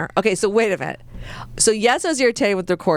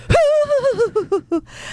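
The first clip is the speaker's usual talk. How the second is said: imitated recorder blast. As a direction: blast a long recorder toot with your voice. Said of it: "with my recorder".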